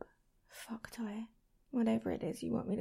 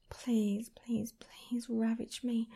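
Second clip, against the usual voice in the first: usual speaking voice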